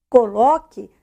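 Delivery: usual speaking voice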